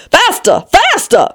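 high, urgent queenly cry. A narrator's reading. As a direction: urge her on with rising pitch. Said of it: "still the Queen kept crying".

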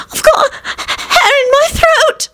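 high, slow tearful whine; irritable. A narrator's reading. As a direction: drag out a wailing complaint. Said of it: "Loretta replied irritably".

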